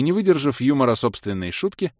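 ordinary delivery, nothing marked

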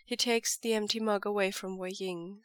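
ordinary delivery, nothing marked

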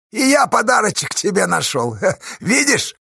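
high, loud, chuckling tease; mock-friendly crowing, pitched high; gleeful villain taunt. A narrator's reading. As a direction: taunt him with a laugh in the voice. Villain gleefully taunts his armed rival.

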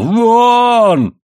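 long, high, loud scream; frenzied yell. A narrator's reading. Husband beside himself throws wife out, screaming. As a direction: hold the single word in a high roar. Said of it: "вне себя заорал Моррелл".

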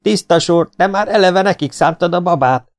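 high, indignant complaint; yelled protest. A narrator's reading. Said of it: "ordította Konrád Feri".

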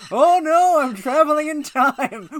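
high, loud fake panic, slowed; panicked mock George Foreman. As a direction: yell it like a man flung through time.

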